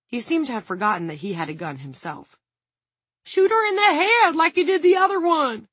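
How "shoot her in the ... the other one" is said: sustained high, loud screaming; panicked scream. A narrator's reading. Guard screams again at the man with rifle, screaming. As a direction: scream the whole plea without letting up. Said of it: "Dawn screamed".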